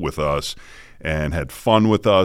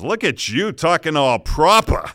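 put-on high, teasing Boston accent, PROPER as "proppa"; mock Boston accent; 9 semitones higher than usual. Old schoolmate mocking his polished speech, thick Boston accent. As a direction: imitate a hometown Boston kid's jeer. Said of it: "we kinda pull out the voice".

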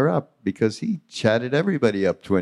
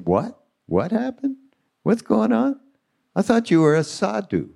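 much higher, playful feigned bewilderment; mock innocent surprise; 12 semitones higher than usual. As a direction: play dumb, eyebrows raised in the voice.

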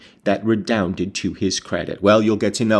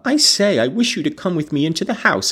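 raised, higher voice as if to someone deaf; crying out; 7 semitones higher, faster than usual. A narrator's reading. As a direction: call out insistently to a deaf man. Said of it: "I cried, thinking him deaf".

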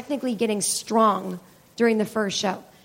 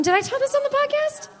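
high voice; 14 semitones higher than usual. Real, not acted. Sudden high aside.

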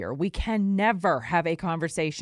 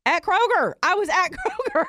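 louder and higher, laughing in disbelief; incredulous; 10 semitones higher, 5 dB louder than usual. Real, not acted. Host telling listeners where it happened, laughing outrage. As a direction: punch "Kroger" with outrage. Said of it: "stresses Kroger, laughing".

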